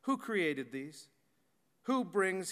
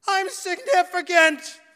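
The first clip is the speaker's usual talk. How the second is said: high, loud, drawn-out cry into space; screaming; 11 semitones higher, 11 dB louder, slower than usual. Retold or imitated. Calvin shouting up at the vast night sky, loud scream. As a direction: shout it up at the stars. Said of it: "he screams".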